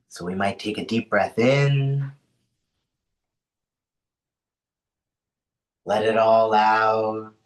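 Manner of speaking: unhurried grounding voice with long pauses; guided meditation. Acted. Host settling an online group into rest, guided meditation. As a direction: ease them into rest, leaving space between phrases.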